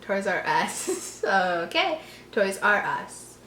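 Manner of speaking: plain reading voice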